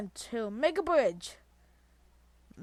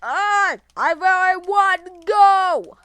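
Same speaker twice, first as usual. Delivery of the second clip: loud whine; 8 semitones higher, 13 dB louder, slower than usual. Real, not acted. Upset pleading with game.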